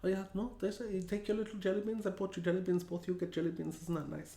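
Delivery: mildly put-on German accent, hushed; a hint of a muttering German aunt; soft German-accented mumble about jelly beans. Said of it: "'Cause she's German".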